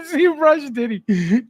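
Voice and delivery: real laughter at a chat typo; laughing. Real, not acted. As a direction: barely get it out while laughing. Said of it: "I'm crying".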